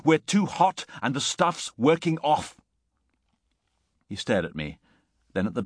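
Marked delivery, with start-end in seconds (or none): 0.1-2.6 high, urgent warning; anxious insistence. A narrator's reading. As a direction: spell out the danger to him. Repeats urgent warning to friend.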